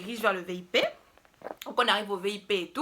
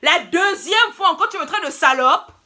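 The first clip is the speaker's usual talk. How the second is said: louder, higher, firm telling-off; angry retort; 3 semitones higher, 7 dB louder than usual. Retold or imitated. Narrator re-enacting telling the man off, loud indignant retort. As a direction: put your foot down, loud and sharp.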